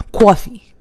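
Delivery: put-on Connecticut accent, "coffee" as kwawfee. Correcting her friend, Connecticut "coffee" accent. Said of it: "some West Coast stuff".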